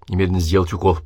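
ordinary delivery, nothing marked

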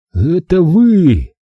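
slow, high-pitched exclamation; dawning realization. A narrator's reading. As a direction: stretch it out as the truth dawns. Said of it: "догадался я".

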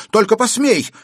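high, loud dare; defiant shout. A narrator's reading. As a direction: shout a warning at the attacker. Girl defies the attacker. (дерзкий предостерегающий крик)

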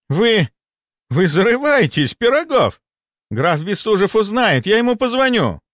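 high-pitched, spluttering indignation; apoplectic threat. A narrator's reading. Enraged deputy threatens to call the count, high and spluttering. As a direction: stammer out the threat, pitch shooting up.

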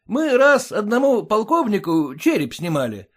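высокий громкий бахвалистый голос; хвастайся, свысока успокаивая баб; хвастливый фельдшер. A narrator's reading. (развязный хвастун)

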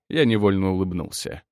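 ordinary delivery, nothing marked

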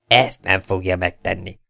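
ordinary delivery

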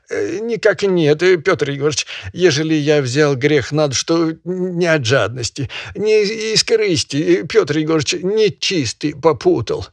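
high, quavering peasant pleading; a hint of a trembling plea to the master; trembling excuse. Peasant excusing himself to the barin, high and quavering.

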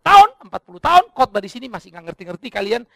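loud, high jab at the congregation; loud exasperated teasing. Acted. Pastor teasing his congregation, loud and high. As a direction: needle them loudly about forty years.

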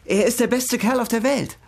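high, pressing plea; urgent urging. Acted. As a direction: push her to say yes, eagerly. Sister urging her to accept.

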